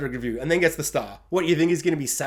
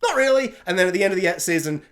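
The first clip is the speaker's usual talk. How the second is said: ordinary talk, nothing marked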